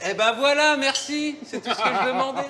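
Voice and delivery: high, fast, laughing delight; thrilled and laughing. Real, not acted. Delighted at guest's Provence reaction.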